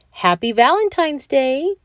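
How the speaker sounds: high, loud festive call; wife bursting with holiday cheer; cheerful shout. A narrator's reading. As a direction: sing it out to wake him. Poking the sleeping bear.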